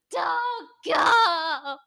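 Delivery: loud, high desperate cry; K-drama plea. Retold or imitated. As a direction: shout it like a drama hero. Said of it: "the most K-drama shit".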